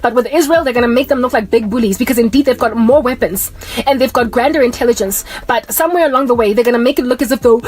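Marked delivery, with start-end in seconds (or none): none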